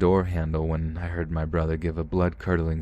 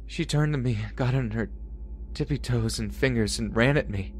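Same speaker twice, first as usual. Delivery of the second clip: put-on frightened child; 6 semitones higher than usual. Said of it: "through his loud sobs".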